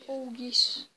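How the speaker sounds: loud happy cheer; excited. Real, not acted. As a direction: burst out in delight. Delighted at chest loot.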